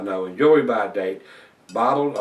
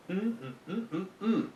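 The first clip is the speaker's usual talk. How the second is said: appreciative mmm; 9 semitones higher, 8 dB quieter than usual. Real, not acted. Savoring the beer's description.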